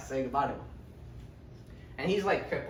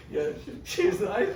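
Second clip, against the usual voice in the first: breaking into a laugh mid-line; chuckling repeat; laughing through it; 8 semitones higher than usual. Retold or imitated. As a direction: giggle through the lie.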